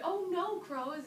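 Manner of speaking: high, loud mock-dismayed cry; dismayed protest. A narrator's reading. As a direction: wail it in hammed-up dismay. Spider acts dismay before crying.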